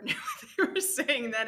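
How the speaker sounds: real laugh breaking into the words; laughing while speaking. Real, not acted. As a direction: let a laugh burst mid-sentence. Laughs recalling garbled radio talk.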